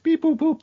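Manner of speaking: squeaky mock keypad beeps; high beeping noises. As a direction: chirp it like button tones. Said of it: "But then she's like".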